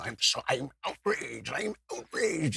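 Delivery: slow, drawn-out put-on indignation; mock outrage. Host imitating angry social media users, mock outrage. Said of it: "like, angry people".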